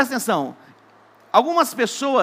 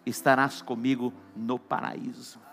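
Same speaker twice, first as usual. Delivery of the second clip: slow solemn promise; 8 semitones lower, 5 dB quieter, slower than usual. Acted. Jesus answers the thief.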